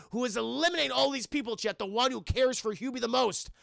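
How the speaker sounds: ordinary delivery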